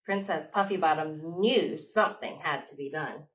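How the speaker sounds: usual speaking voice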